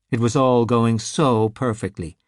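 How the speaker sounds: ordinary delivery, nothing marked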